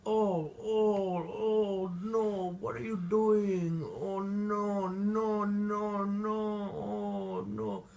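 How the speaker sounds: high, loud whiny panic; hammed-up panicking Watson; mock panic. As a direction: wail like a nervous wreck. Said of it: "without being like".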